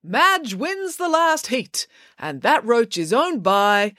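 slow, high, triumphant announcement; race announcer. Acted. Mister Lang announces the last heat's winner, drawn-out announcer call.

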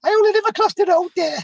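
grateful neighbour quoted in a very high voice; high-pitched voice. Retold or imitated. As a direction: redo it squeaky and high like the man. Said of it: "didn't have quite a high-pitched voice like that".

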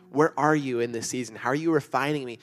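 impassioned pulpit plea, voice lifted high; preaching at full force. Acted. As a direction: pour it out like a pleading prayer from the pulpit. Preaching builds to impassioned cries.

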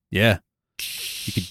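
ordinary talk, nothing marked

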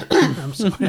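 coughs, then a strained apology; coughing fit. Real, not acted. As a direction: cough through the words. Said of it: "I'm still coughing".

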